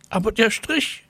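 put-on a boy whining about the chalk mark. A narrator's reading. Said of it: "jammerte Mirko". (Schüler jammert, viel höher)

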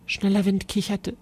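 usual speaking voice

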